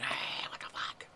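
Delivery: voiceless, drawn-out mock scream; retold scream. Neighbor screams at the lady again, voiceless mock scream. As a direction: imitate screaming without full voice, slowly. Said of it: "I scream at her again".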